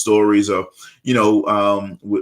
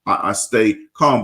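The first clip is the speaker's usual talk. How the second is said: ordinary talk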